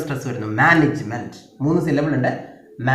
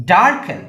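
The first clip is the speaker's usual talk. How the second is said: Darken with a loud, high first syllable; first-syllable stress; 6 semitones higher, 6 dB louder, slower than usual. Shown as a demonstration. Teacher modelling stress, first syllable of darken punched. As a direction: hit the opening of darken. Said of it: "first syllable stress".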